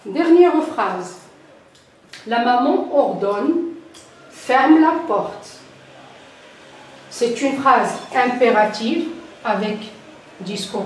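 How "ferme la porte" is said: «Ferme la porte.» said slow and louder, starting high and falling; a mother's firm order, the pitch dropping at the end; falling command tone. Shown as a demonstration. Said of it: "elle est descendante". (intonation descendante, ordre lent et appuyé sur «Ferme la porte.»)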